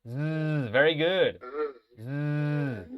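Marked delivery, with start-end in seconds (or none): 0.0-0.7 a long voiced zzz sound alone; buzzing z. Shown as a demonstration. Teacher shows the bare z sound to the class. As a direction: buzz the Z like a bee. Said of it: "hagan solo el sonido de la z".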